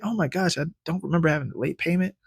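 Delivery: finding out about a forgotten late payment; alarmed surprise. Retold or imitated. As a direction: blurt out the shock.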